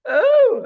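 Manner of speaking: OH swooped up very high and loud; mock the woke lilt with a high, loud OH; exaggerated high-pitched OH; affected intonation. Shown as a demonstration. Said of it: "they put a, an intonation".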